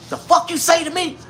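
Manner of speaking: high, indignant woman snapping back; hammed-up offended lady; 1 mock angry woman. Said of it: "her face went from smiling".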